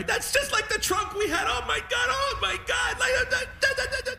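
high, overwhelmed, tearful dad gushing; weepy excited dad. Retold or imitated. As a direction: squeal it like a dad overcome with tears. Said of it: "everything made him cry".

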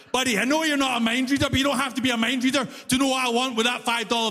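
high, fast-starting exasperated lecture; exasperated rant. Retold or imitated. Commuter lectures the fare collector on his job, exasperated. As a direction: spell it out for an obtuse clerk, pitched high. Rant he wished to give clerk.

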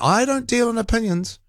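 high mock voice of a caller; John. Host quoting a caller who pretends neutrality, high-pitched mimicry. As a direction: lift the pitch way up, mimicking his protest. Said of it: "he pretends he's not giving an opinion".

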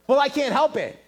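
usual speaking voice

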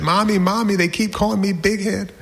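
high, mock-tearful child voice; crying little boy. Toby complains to mommy of teasing, crying. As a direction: whine it high like an upset kid. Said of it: "the whole snotty stutter breath thing".

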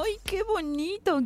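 high, slow delighted "ay"; touched sigh. Real, not acted. Host moved by a listener's poetic message. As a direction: melt at something sweet.

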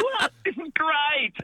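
mock delighted young performer; excited young self. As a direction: squeak it out like a kid who got the dream job. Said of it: "And I'm like".

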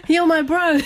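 laughing through the words, pitched high; half-laughing, high and amused; laughing quote. Retold or imitated. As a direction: giggle out the quote. Laughing while quoting son.

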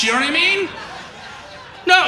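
ordinary talk, nothing marked

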